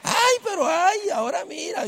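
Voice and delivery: high, slow mock-swooning coo; hammed-up gossiping classmates; imitating classmates. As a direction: stretch it out high like teasing girls. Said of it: "Las muchachas".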